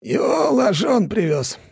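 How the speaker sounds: slow, high elder, smug smile; condescending. A narrator's reading. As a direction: explain with a patronising smile. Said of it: "снисходительной улыбкой". (снисходительно)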